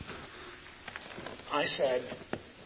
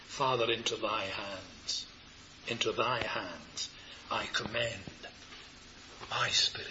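low, slow, soft committal; hushed prayer; 6 semitones lower, 8 dB quieter than usual. Acted. Preacher saying with the Saviour the last word, hushed. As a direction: pray it softly, fading away at the end.

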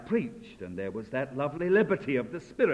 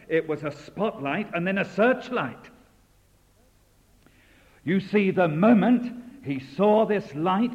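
raised, building pulpit preaching; 6 semitones higher, 7 dB louder than usual. Acted. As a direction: press the image of the light home. Builds exhortation on the glory.

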